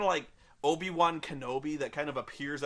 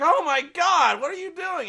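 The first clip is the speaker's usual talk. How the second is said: much higher, louder panicked shout; alarmed yell; 11 semitones higher, 8 dB louder than usual. Retold or imitated. Jacob seeing Bella crash, high loud alarmed. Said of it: "He's like".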